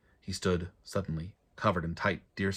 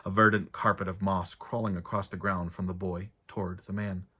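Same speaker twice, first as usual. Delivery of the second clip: plain reading voice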